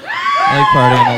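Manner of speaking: high, slow hammed-up party yell; mock party guy. Comic hamming up a party bro. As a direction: bellow it like a dumb frat guy.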